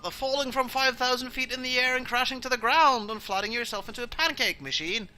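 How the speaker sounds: high, loud daughter voice; pointed high-pitched correction; exasperated daughter. Acted. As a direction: reel it off in a high, loud protest. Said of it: "Cinda prefers to call that".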